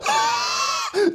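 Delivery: scornful cackle shooting way up; mocking high laugh. Dad laughing off the son's wish for a new car. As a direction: burst out laughing at the son's request.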